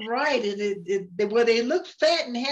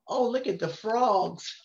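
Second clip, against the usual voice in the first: usual speaking voice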